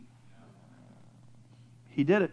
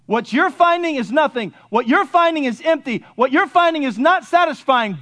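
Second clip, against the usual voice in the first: higher, rhythmic pulpit repetition; insistent preaching; 8 semitones higher, 6 dB louder than usual. Acted. Preacher telling seekers their search is empty, insistent preaching. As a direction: build each repeated phrase like a preacher.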